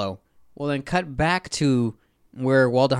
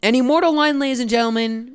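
grand announcer build-up; 8 semitones higher than usual. Pretend. Host teasing the famous line to listeners, mock-grand announcing. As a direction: proclaim it like a showman, building up.

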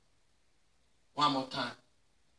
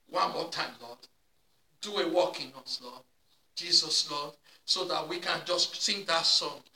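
softer, lower prayer winding down; quiet closing prayer; 6 semitones lower, 5 dB quieter than usual. Acted. Pastor closing the service prayer, soft and earnest. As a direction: settle into a hushed, earnest prayer.

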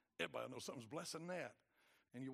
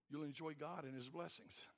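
ordinary talk

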